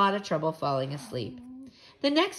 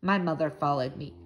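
plain reading voice

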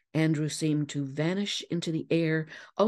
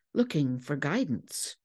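ordinary delivery

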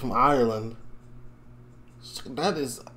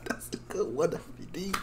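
whispered aside; 5 semitones higher, 7 dB quieter, faster than usual. Real, not acted. Quiet aside after clip.

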